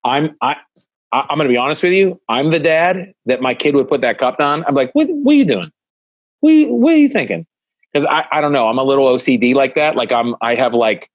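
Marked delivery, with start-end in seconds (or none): 4.9-7.4 put-on high, loud alarmed cry at the kid; alarmed dad yell. Dad catching his kid with the cup, high alarmed yell. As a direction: squawk it like a panicked dad.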